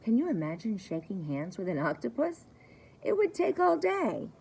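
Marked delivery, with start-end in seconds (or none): 3.0-4.3 squeaky high, amused punchline; playful surprise. Acted. Teacher to young listeners, playfully high and amused. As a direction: pitch way up for the joke.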